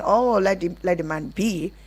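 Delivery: usual speaking voice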